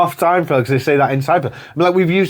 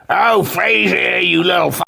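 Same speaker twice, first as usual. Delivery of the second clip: drawn-out, higher mock grumpy dad; grumpy old man; 5 semitones higher than usual. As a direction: imitate a grumbling old father. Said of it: "he's just a grumpy old man".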